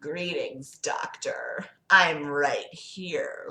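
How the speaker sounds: low, soft, drawn-out devil voice; sinister Lucifer. Acted. Lucifer greeting the doctor from behind, low sinister voice. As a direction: drop low and stretch it menacingly.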